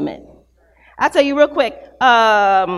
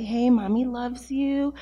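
put-on much softer, gentle with a baby; soft bedtime voice; 10 dB quieter than usual. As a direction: hush a toddler to sleep, whispering. Putting toddler to bed.

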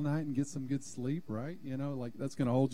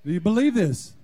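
emphatic repeat; 4 semitones higher, 10 dB louder than usual. Acted. Pastor repeats the question louder.

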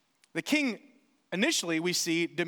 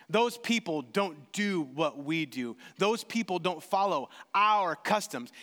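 mock prejudiced voice; 7 semitones lower than usual. Voicing prejudiced charges.

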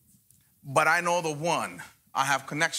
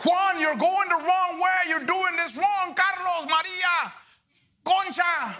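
voice of God correcting; 13 semitones higher, slower than usual. Pretend. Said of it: "that's why he tells you".

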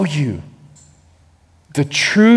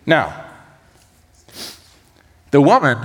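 usual speaking voice

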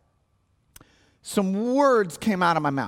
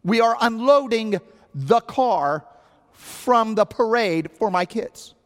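slow, higher, heavily separated words; pointedly slow explanation to a stranger; every word its own sentence; 6 semitones higher, 3 dB louder, slower than usual. Retold or imitated. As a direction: stop dead between the words. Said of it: "Every word was its own sentence".

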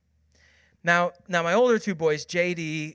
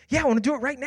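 lightly voiced eager child, higher pitch; 6 semitones higher, much faster than usual. Daughter answers dad's question about asking forgiveness, eagerly. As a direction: answer like a keen little girl.

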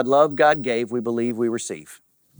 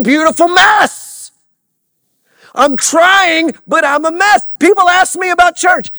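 high, loud preacher's cry; full-force preaching; 13 dB louder than usual. Acted. Preacher crying out to the congregation, loud and high. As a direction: preach it out to the church.

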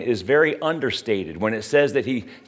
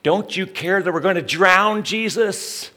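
mild mock panic; 6 semitones higher than usual. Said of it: "they cried out to Jesus".

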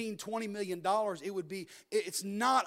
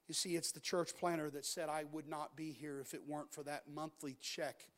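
softer, lower, earnest preaching; quiet sermon appeal; 4 semitones lower, 5 dB quieter than usual. Acted. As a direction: bring it down to an earnest hush. Preacher drops to intimate appeal.

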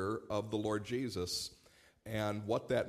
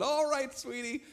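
put-on much higher, louder gentle child-directed voice; talking kindly to a toddler; sweet talk to a toddler; 11 semitones higher, 6 dB louder than usual. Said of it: "So I just said".

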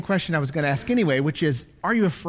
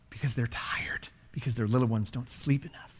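hushed whisper to weary parents; preacher whispering sympathy to exhausted parents; 8 semitones lower, 11 dB quieter than usual. Real, not acted. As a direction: drop to a whisper, gentle and close. Tender aside to tired parents.

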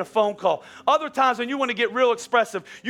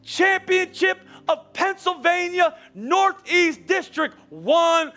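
slow, higher, fervent mock proclamation; mock sports fan; 8 semitones higher, slower than usual. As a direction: proclaim it like a hyped-up parent. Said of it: "We're like".

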